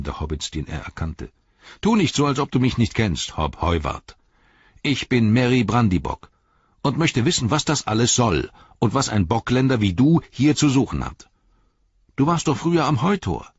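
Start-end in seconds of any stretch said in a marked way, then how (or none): 1.8-13.5 loud, higher demanding voice; loud stern hobbit; indignant scolding. Acted. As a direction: scold him through the gate. Merry confronts Hob.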